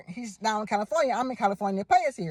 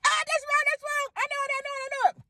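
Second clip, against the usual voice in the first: very high, squealing eager cry; bouncing with impatience, desperate to answer; frantic excitement; 14 semitones higher than usual. Retold or imitated. Caller on hold squealing at a wrong answer, very high and frantic. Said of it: "I'm like".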